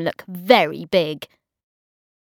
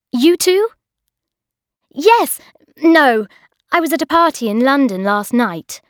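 high, fast flustered reply; flustered chatter; 6 semitones higher, 6 dB louder than usual. A narrator's reading. Bridget blurting too quickly to Mark, high and fast. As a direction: gabble nervously. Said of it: "I replied".

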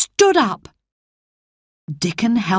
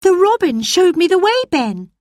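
put-on girl Mary; 11 semitones higher, 4 dB louder, faster than usual. Mary answers quickly.

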